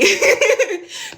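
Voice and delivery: a burst of laughter, high; bright laugh. Real, not acted. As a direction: laugh at the memory. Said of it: "laugh before the repeat".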